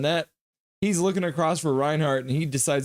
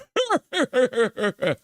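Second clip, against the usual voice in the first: a bright burst of laughter, higher; 10 semitones higher than usual. Real, not acted. Streamer laughing at a chat comment, a high laugh. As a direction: laugh out at a joke.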